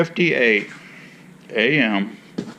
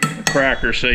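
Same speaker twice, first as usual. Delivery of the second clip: usual speaking voice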